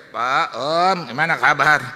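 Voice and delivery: loud, high-pitched call to a neighbour; shouted greeting. Retold or imitated. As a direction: imitate someone hailing you at full voice. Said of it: "dia teriak saya".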